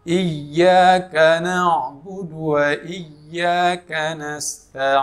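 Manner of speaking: slow, drawn-out tajwid chant; slow melodic Quran recitation. Shown as a demonstration. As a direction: recite it slowly like tilawah. Recites verse.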